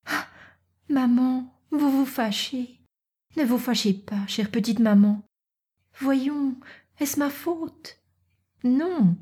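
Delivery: put-on high, soft girlish plea to her mother; pleading young Natacha.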